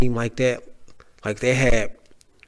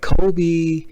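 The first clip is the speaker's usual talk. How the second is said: calling Kobe on a shot; 6 semitones higher, 3 dB louder than usual. Retold or imitated. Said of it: "you're like".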